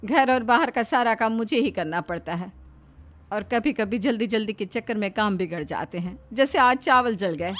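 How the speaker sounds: lightly voiced housewife, mildly aggrieved; mild complaint from an overworked wife; protesting housewife. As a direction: explain yourself with a touch of grievance.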